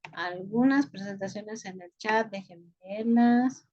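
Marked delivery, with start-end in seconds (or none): none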